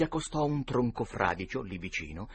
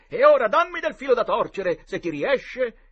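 sfida recitata; 12 semitones higher, 10 dB louder than usual. Acted. Tom challenges in play duel.

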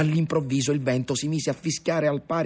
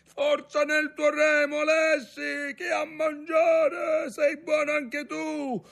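high, slow, carrying shouts; shouted urging; slower than usual. A narrator's reading. Ntoni urging young Alessi to row, shouting. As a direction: spur the rower on over the gale. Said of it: "gridò Ntoni".

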